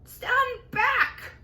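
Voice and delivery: high, loud warning shout. Acted. Aunt shouting at all to stand back. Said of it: "Aunt Sponge shouted".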